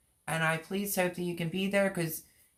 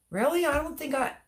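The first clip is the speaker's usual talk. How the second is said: genuinely taken aback, voice raised high; surprised; 9 semitones higher, faster than usual. Real, not acted. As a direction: react in disbelief, pitch jumping up. Reacts to Gail being shocked.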